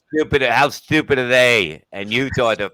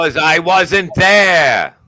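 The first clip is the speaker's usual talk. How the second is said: slow, loud smug comeback; cocky retort; 8 semitones higher, 6 dB louder, slower than usual. Pretend. Comic answering a friend's jab, slow smug boast. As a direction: drag it out with full self-importance. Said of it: "cocky retort".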